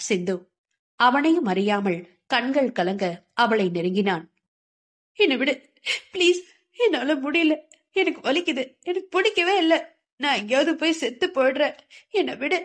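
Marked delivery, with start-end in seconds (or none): 5.2-12.6 higher, crying pleas of exhaustion; sobbing plea. A narrator's reading. Sindhu sobbing in her nightmare, high tearful begging. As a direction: beg through tears, worn out. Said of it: "குரல் தேய்ந்து கொண்டே போக".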